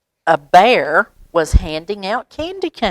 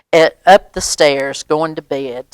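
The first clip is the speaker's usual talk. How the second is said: ordinary delivery, nothing marked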